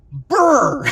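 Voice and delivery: loud, high brr from the cold; shivering brr. Real, not acted. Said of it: "It's cold out".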